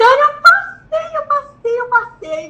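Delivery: high, drawn-out triumphant yell; re-enacted shriek of joy; gritando, excited shout. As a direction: yell it down the corridor, then ease off. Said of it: "eu saí no corredor gritando".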